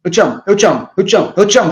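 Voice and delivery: hammed-up clingy "eu te amo" spree; mock needy repetition. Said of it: "Fica um negócio esquisito".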